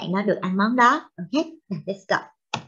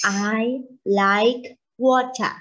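slow model reading; much slower than usual. Shown as a demonstration. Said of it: "Now you say again".

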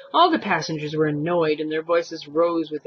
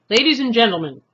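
loud, higher announcement; calling out; 6 semitones higher, 8 dB louder, faster than usual. A narrator's reading. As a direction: call over the whole crowd. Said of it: "called the driver".

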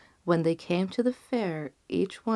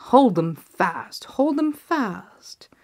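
louder, slow bellow; bawled; 4 semitones higher, 7 dB louder, slower than usual. A narrator's reading. Fat innkeeper arriving last, bawling at the crowd. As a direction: bellow it across the fair. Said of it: "bawled the innkeeper".

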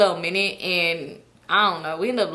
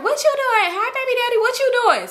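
much higher taunting friend voice; mock teasing sing-song; 13 semitones higher than usual. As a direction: tease the baby daddy way up high. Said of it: "So she was like".

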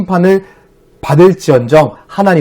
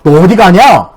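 retold alarm, raised and louder; flustered; 4 semitones higher, 6 dB louder than usual. Dad retelling his panic as his daughter leaves, flustered. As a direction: call out as a caught-off-guard parent. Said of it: "제가 너무 당황해서".

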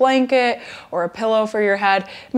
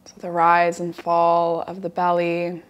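guided relaxation. Acted. Yoga teacher guiding students lying down to relax, guided relaxation. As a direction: settle them gently into the breath, unhurried.

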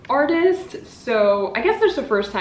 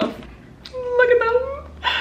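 high drawn-out gush; much slower than usual. Real, not acted. Admiring the books.

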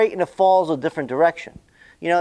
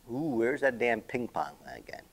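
quiet mutter, fading to a whisper; muttering to himself; 6 semitones lower, 11 dB quieter than usual. Real, not acted. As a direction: mumble it under your breath while searching. Muttering to himself while searching.

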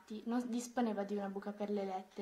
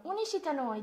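higher, eager invitation; calling out; 6 semitones higher, 4 dB louder, faster than usual. A narrator's reading. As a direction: call up to someone passing overhead. Boys call up to flying girl. (richiamo entusiasta)

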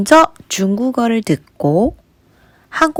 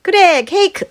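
높고 밝게 아이를 이끌며; 유아에게 신나게 묻는 말투; 아이에게 말하듯; 8 semitones higher than usual. Acted. High bright cue to toddler. (아이에게 칭찬하며 묻는 선생님 말투)

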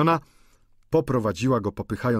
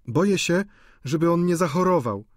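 put-on higher, anxious girl voice; worried girl; 8 semitones higher than usual. A narrator's reading. Victorine worrying the student will fall ill. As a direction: fret about him.

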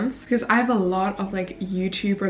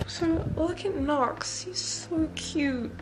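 cooing at pet; 7 semitones higher, 5 dB quieter, much slower than usual. Real, not acted. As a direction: coo lovingly at the pet. Cooing over her pet.

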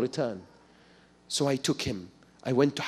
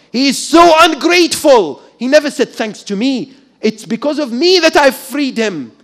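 indignant; 10 semitones higher, 10 dB louder than usual. Retold or imitated. Soldier's resentful inner thought.

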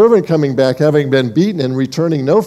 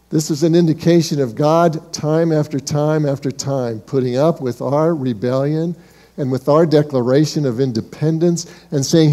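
ordinary talk

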